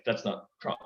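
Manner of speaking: ordinary talk, nothing marked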